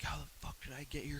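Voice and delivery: whispered, rattled disbelief; panicked whisper. Retold or imitated. Fighter retelling waking up lost, whispering in alarm. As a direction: hiss it under your breath, half panicked.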